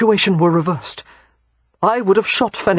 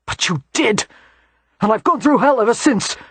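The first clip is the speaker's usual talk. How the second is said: enraged soldier shouting; 4 semitones higher than usual. Acted. Bennett accuses Christopher, gun drawn.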